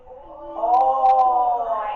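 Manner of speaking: "Oi" held long and loud; stretched out. Shown as a demonstration. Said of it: "Let's stretch it out vertically".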